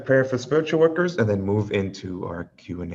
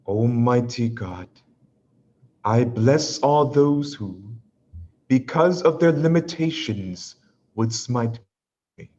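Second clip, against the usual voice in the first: louder, measured prayer reading; prayer recitation; 5 semitones higher, 5 dB louder, slower than usual. Acted. Leads a spoken prayer.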